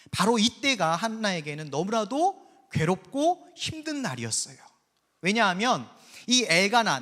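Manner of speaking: ordinary talk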